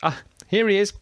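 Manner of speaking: high-pitched call of recognition. A narrator's reading. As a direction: call out high as you spot him. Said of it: "called out Thomas again".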